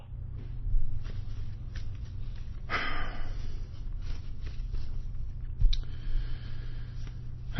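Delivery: very long, soft exhale; heavy fed-up sigh; weary sigh. Real, not acted. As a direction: breathe out all the frustration. Spent and frustrated.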